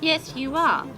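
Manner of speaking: high cooing to a doll; baby talk. Acted. Storyteller coos to the doll, high baby talk. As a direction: coo like talking to a baby.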